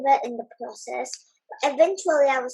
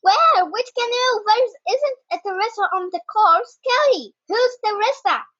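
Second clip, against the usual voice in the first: aggrieved shout; 7 semitones higher than usual. Acted. Jake and Sally rushing over, shouting urgently. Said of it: "they shouted aggrievedly".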